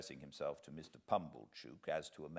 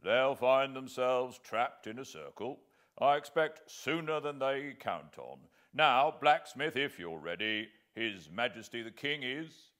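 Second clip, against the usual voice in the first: higher, louder commanding soldier; a sergeant giving orders to the blacksmith; 5 semitones higher, 7 dB louder than usual. Acted. Sergeant voiced in the story.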